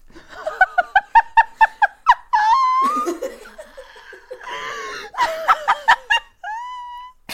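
loud, high-pitched screaming laughter; laughing fit. Real, not acted. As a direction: burst into long, helpless, shrieking laughter. Helpless laughing fit over mispronounced names.

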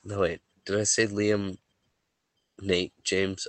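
ordinary talk, nothing marked

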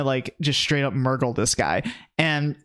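ordinary talk

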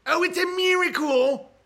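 high, loud mock wonder; high exaggerated oh, it's a miracle; hammed-up amazement. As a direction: exclaim the miracle in mock awe. Mock exclaiming.

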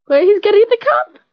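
high, loud alarmed cry; sudden panic, voice jumps up. Real, not acted. Alarm at ice cream vendor.

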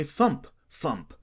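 ordinary delivery, nothing marked

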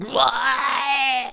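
high, loud drawn-out puking groan; imitated retching. Imitating the man in the wheelchair throwing up, mock retch. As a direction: mimic a man heaving into a bag. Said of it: "That's what he sounds like".

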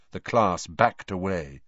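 plain reading voice

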